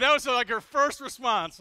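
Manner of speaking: words pushed out through loud, high laughter; loud, high laughing praise of his wife's joke; laughing loudly. Real, not acted. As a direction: still cracking up, loud and high.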